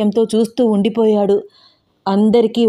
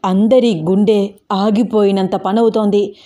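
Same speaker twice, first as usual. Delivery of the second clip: ordinary delivery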